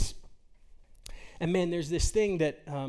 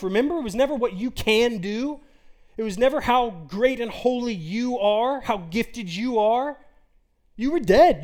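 louder, higher, hammering each never; preaching at full force; 4 semitones higher, 6 dB louder than usual. Acted. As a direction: drive the point home from the pulpit.

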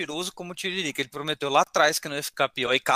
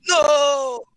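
shouted no; 12 semitones higher, 7 dB louder than usual. Retold or imitated. Echoing the Vader scene shout.

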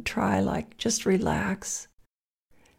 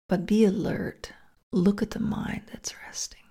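guided meditation. Acted.

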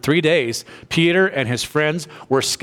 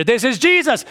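much higher and louder, sudden joy; excited realization; 11 semitones higher, 4 dB louder than usual. Retold or imitated. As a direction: burst out with the discovery. Peter realizing it's Jesus.

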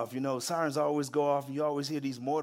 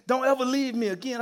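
high-pitched, lightly acted plea; shaken plea; 8 semitones higher, 3 dB louder, faster than usual. Shaken troop to returning sergeant, mock rattled plea. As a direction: imitate a rattled soldier, pitched up.

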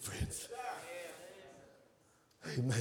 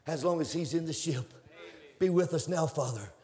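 ordinary talk, nothing marked

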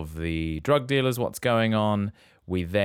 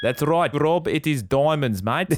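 put-on Brummie-South African accent, higher and louder; excited confirmation; 6 semitones higher, 4 dB louder than usual. Host confirms the right guess to Rob, excited accent. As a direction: cheer the right guess, mate.

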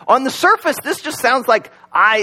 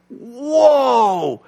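mock amazed whoa; 5 semitones higher than usual. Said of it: "light up and go".

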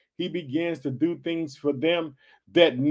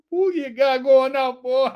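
laughing; 6 semitones higher, 6 dB louder, slower than usual. Real, not acted. Joking aside with laugh.

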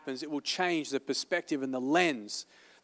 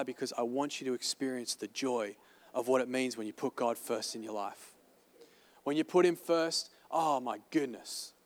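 softer sincere appeal; 3 semitones lower, 6 dB quieter than usual. Real, not acted. Pastor turns intimate about joy.

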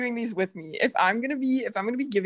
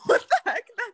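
laughing in disbelief; 11 semitones higher than usual. Real, not acted. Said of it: "friend amazed, laughing hard".

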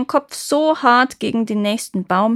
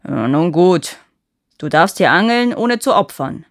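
grumbling giant; 7 dB louder than usual. Acted. Giant gives in.

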